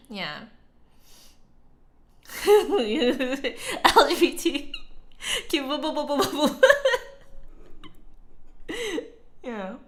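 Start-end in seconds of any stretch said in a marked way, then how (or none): 2.5-6.6 giggling through the words; laughing. Real, not acted. Repeating the joke to chat, giggling. 6.6-9.4 breathy high giggle. Real, not acted. Reacting to chat, giggling.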